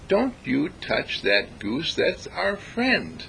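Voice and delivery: slow stern mock scold with a broom; woman scolding soldier. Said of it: "this woman taking a broom".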